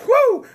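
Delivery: high, loud joyful whoop; spontaneous cry of praise. Real, not acted. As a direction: let out a high happy whoop. Preacher fired up.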